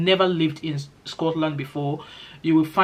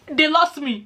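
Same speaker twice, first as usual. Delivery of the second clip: much higher, just after a laugh; amused repeat; 10 semitones higher than usual. Real, not acted. Telling viewers about losing track of colleagues' chat, amused repeat. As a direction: repeat it tickled, pitched right up.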